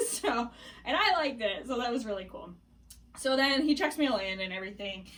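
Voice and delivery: ordinary talk, nothing marked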